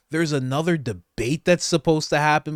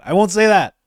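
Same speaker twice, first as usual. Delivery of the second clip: "I won't say that" much louder and higher; loud insistence; 6 semitones higher, 7 dB louder, faster than usual. Real, not acted. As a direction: declare it firmly.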